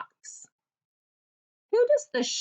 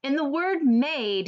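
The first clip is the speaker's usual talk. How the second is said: ordinary talk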